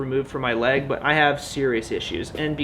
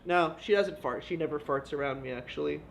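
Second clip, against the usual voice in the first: much softer, backing off; quiet retraction; 10 dB quieter than usual. Real, not acted. Vlogger walks back his joke about his wife, much softer.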